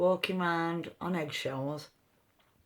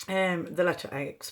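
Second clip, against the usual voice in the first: ordinary talk, nothing marked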